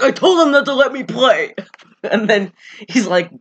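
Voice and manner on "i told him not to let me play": higher-pitched mock complaint; retold whine. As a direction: voice an exasperated teen protesting.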